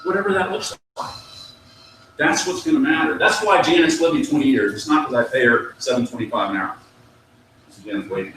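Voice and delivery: usual speaking voice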